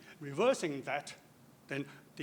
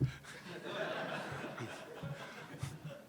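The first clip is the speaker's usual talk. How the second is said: soft laugh; 4 semitones lower, 9 dB quieter than usual. Real, not acted. Laughs at his own retirement joke.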